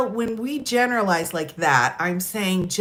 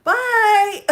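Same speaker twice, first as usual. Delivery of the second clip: laughing goodbye; 12 semitones higher, 5 dB louder than usual. Retold or imitated. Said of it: "I was like".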